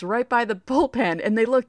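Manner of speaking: usual speaking voice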